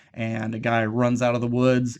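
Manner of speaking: ordinary delivery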